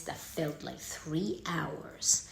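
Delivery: slow whispered aside; dragging whisper. Real, not acted. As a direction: breathe it out slowly, long-suffering. Aside about waiting.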